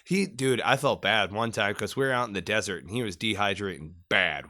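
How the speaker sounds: normal talking voice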